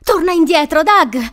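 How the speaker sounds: loud, high urgent shout; yelling. A narrator's reading. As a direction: yell to call someone back. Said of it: "urlò Crystal".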